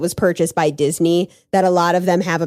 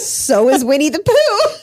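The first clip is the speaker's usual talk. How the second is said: higher, louder, slow triumphant comeback; triumphant retort; 8 semitones higher, 4 dB louder, slower than usual. Retold or imitated. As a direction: slam the point home, high and slow.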